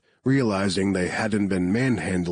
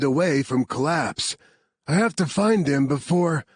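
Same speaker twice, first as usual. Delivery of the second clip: a higher, pressing soldier voice; urgent rescuer; 7 semitones higher than usual. Acted. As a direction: hurry them, the castle is falling. Marcus gives urgent orders.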